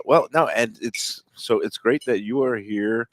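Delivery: usual speaking voice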